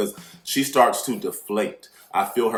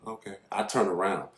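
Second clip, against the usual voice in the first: ordinary delivery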